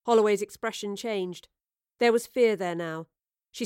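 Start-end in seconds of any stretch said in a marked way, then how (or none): none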